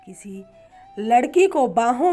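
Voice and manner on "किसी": ordinary delivery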